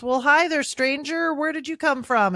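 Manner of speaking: high, loud, folksy hello; friendly clerk. Retold or imitated. Sister voices the gas station clerk greeting a stranger, high and loud. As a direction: greet the stranger like a chirpy small-town clerk. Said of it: "And the clerk says".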